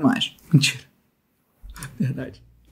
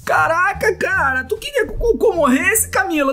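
high, exasperated reproach; high indignant scolding; 6 semitones higher than usual. Real, not acted. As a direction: scold her in disbelief. Scolding builder for killing pet. (bronca indignada e aguda)

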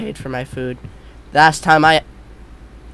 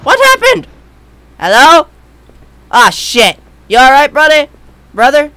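high, loud alarmed calling; startled, call out to him; alarmed shout; 10 semitones higher, 12 dB louder than usual. Real, not acted. Finds a bleeding man.

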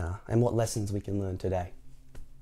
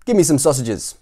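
loud, higher snapped order; Mister Grumpy; 5 semitones higher, 10 dB louder than usual. A narrator's reading. Grumpy customer orders the butcher about, snapping. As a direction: demand it curtly. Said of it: "snapped Mister Grumpy".

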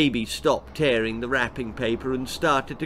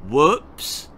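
smirking; 2 semitones higher, 5 dB louder than usual. A narrator's reading. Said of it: "Girl smirked".

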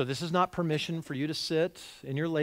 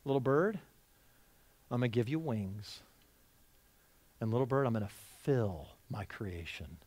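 softer, lower, gentle voice of God to a bird; God speaking tenderly; 5 semitones lower, 6 dB quieter than usual. Acted. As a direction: speak softly like a kind father. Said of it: "You know what God says?".

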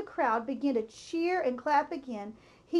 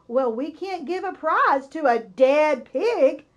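loud announcer; 3 semitones higher, 8 dB louder than usual. A narrator's reading. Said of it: "said the loudspeaker".

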